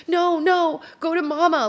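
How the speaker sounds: put-on high, loud little kid protest; upset toddler. Toddler son refusing grandma's arms, high and loud child voice. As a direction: push away like a cranky toddler.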